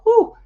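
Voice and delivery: high, loud whew of relief. Real, not acted. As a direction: blow out a big whew. After nine percent alcohol story.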